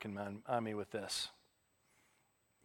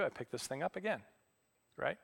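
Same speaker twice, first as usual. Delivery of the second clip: ordinary delivery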